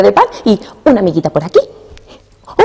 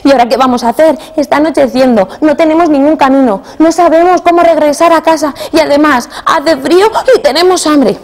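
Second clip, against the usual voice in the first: fearful; 7 semitones higher, 3 dB louder than usual. Acted. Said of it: "Y ahora sí entraba el miedo".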